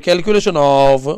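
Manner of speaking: slow, low, stretched-out words; drawn-out class prompt. Real, not acted. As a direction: draw it out for the class to join in. Teacher cueing class to chant along. (वर्गाला सोबत म्हणायला लावत, शब्द लांबवत)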